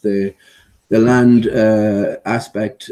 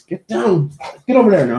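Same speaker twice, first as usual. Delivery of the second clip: higher-pitched mock command; feigned indignation; 6 semitones higher than usual. Owner sending the dog away, mock-indignant. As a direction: play at ordering someone away sternly. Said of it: "it's sort of feigned indignation".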